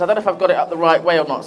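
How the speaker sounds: ordinary delivery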